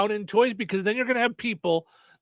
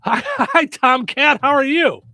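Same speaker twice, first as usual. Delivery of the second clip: excited radio host; 7 semitones higher, 7 dB louder than usual. Real, not acted. Greets caller on air.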